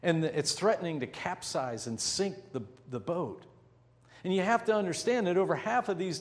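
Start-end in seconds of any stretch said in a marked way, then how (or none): none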